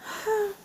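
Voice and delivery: imitated high, soft sigh; small high breath out, mimicking a toddler; toddler's sigh. Mom imitating her daughter at the back door, high sigh. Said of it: "and she sighs".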